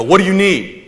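playing the big tough guy on the phone; acting tough. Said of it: "I'm acting all tough".

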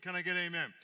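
rally the congregation with a shout; call for amen. Real, not acted. Preacher calling to congregation.

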